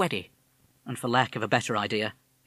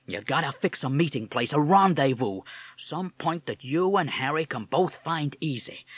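brash streetwise American bird; cocky streetwise pep talk; tough talking parrot Mad; 3 semitones higher than usual. Acted. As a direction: talk yourself through it like a wise guy. Mad's inner street-wise monologue.

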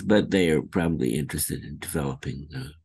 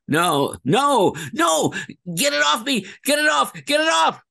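panicked scream; 4 dB louder than usual. Acted. Performing the famous game line.

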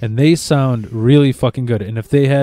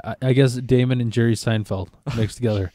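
normal talking voice

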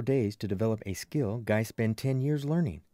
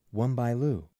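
ordinary delivery

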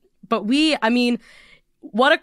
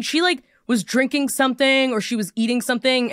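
ordinary delivery

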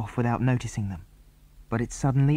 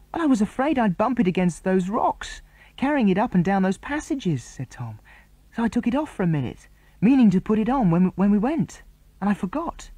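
high, fast, pleading excuse; near tears; 10 semitones higher, 3 dB louder than usual. A narrator's reading. Tom to the others, high tearful excuse. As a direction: babble it out close to crying. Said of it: "looking almost ready to cry".

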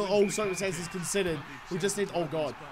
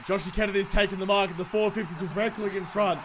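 ordinary delivery

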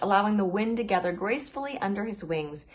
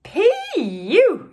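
squealed much higher and louder; shrill disgusted yelp; high-pitched yell; 12 semitones higher, 9 dB louder than usual. A narrator's reading. Sugar glider smelling the pangolin's poof, high yell. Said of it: "yelled the high-pitched voice".

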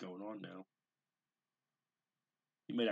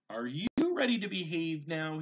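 mother's question; 9 semitones higher, 8 dB louder than usual. A narrator's reading. Mother asking child.

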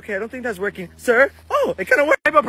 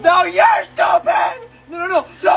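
shrieking no at the bully, much higher and louder; angry shout; 6 semitones higher, 4 dB louder than usual. Acted. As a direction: scream it like a furious kid. Kid fighting bully over turtle.

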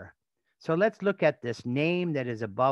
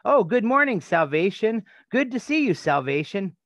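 bright mock greeting, much higher and louder; cheery greeting; 7 semitones higher, 5 dB louder than usual. A townsperson cheerfully greets Jesus by name. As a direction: greet him like an old friend.

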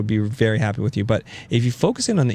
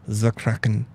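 Kraken in a mock Austrian-German accent, the Kraken rolled out thick; fake German accent; slower than usual. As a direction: say the Kraken like a hammy Austrian villain. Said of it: "I said that in a Ger-, fake German, Austrian thing".

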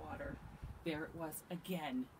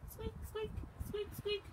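tiny high-pitched squeaks; lantern squeak. A narrator's reading. Reader mimics lantern swinging in the dark, squeaky. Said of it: "went the lanterns".

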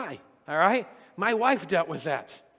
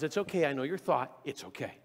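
tender God; 5 semitones lower, 5 dB quieter than usual. Retold or imitated. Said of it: "But God says".

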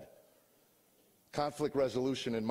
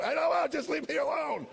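much higher, louder, strained yell; strained shout; 6 dB louder than usual. Retold or imitated. Husband at the peak of a kitchen fight, strained shout. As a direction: let the voice crack at the top of the fight.

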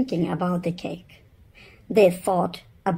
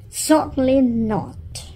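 raised, brisk no from mum; firm mother voice; 6 semitones higher than usual. Acted. Mother refusing the children, firm and raised. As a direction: put your foot down as the mother. Mrs Lars refuses firmly.

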